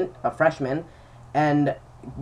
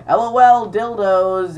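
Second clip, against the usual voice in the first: higher, louder, slow jokey spell-out; mock goofy president; 5 semitones higher, 6 dB louder, slower than usual. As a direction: ham up the class clown presenter. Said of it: "he's a very funny guy, very expressive".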